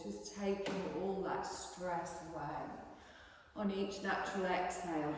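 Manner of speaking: calm, even relaxation guidance; guided meditation. Acted. Yoga teacher guiding the class into relaxation, soothing guided voice. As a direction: ease the class gently into stillness.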